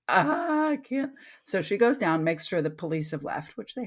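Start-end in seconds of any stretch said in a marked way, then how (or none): 0.1-1.1 high, broken cry of giving up; a small defeated wail; overwhelmed. Retold or imitated. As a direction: let it crack out, stripped bare. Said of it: "so stripped bare".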